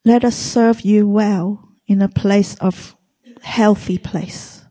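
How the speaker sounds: hushed church prayer. Acted. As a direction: pray it gently to the Lord. S0 closing prayer.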